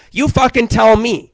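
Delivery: exasperated yell at the listener; exasperated outburst. Real, not acted. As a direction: throw the question at them, fed up.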